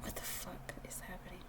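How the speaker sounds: breathless near-tearful whisper; overwhelmed whisper. Real, not acted. Reader moved by a sad pile, whispering near tears. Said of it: "I feel like I'm gonna cry".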